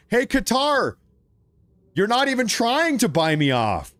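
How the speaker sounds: a high, playful hail across the room; mock call-out. Streamer jokingly hails Qatar to bribe him, high mock call. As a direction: call out to Qatar like an old friend.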